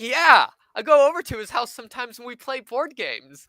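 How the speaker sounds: high, loud, overly keen; retold eager reply. Guest explaining a mutual friend to a new acquaintance, eager.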